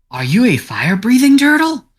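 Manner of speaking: high, loud incredulous question; astonished cat. Acted. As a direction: gape at the turtle in amazement. Singed cat astonished by turtle.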